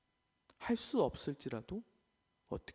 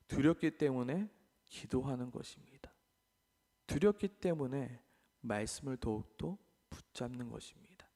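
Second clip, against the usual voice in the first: ordinary delivery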